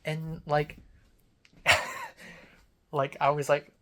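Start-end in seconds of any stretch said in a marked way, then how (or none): 1.6-2.3 sudden squeaky laugh; high burst of laughter. Real, not acted. Laughing at own joke.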